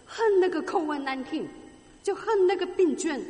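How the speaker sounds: rising angry preacher's cry; heated outburst. Acted. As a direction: spit out your hatred of the disease. Preacher voicing hatred of disease.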